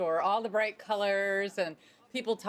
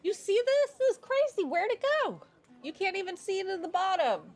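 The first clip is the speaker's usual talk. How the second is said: amazed; 12 semitones higher, 4 dB louder, faster than usual. Real, not acted. Player marvels at the well.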